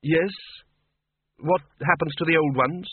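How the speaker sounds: ask, drawn in despite yourself; reluctantly interested. A narrator's reading. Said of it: "interested despite himself".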